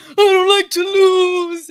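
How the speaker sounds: high, loud, slow sobbing whine; mock bawling. Imitating Shakur bawling after the loss, slow loud wail. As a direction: stretch it out like a kid crying hard. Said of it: "he's fucking bawling his eyes out".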